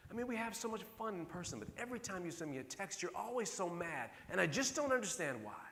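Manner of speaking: a grown daughter confronting her dad; imitating his daughter. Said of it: "She goes".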